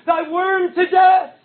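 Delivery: high, loud despairing shout; trembling cry. Acted. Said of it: "I trembling cried".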